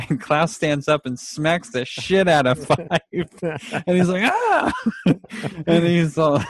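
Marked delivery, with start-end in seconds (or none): 4.3-4.7 a very high laughing yelp; mock yelp. Five yelping after Klaus smacks him, high and laughing. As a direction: squeal the ouch through a laugh.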